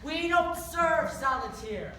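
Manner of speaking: "don't" barked loud and slow; loud slow repeat. Acted. Leprechaun shouting at the rude customers, slow and loud. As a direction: lose patience and shout it slowly. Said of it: "single word I've been saying".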